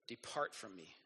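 low, soft solemn God. Retold or imitated. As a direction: pronounce it like a grieved judge, low and hushed. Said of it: "And God's going".

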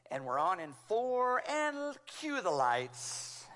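mock producer barking cues; barking orders. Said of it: "I hear her barking orders".

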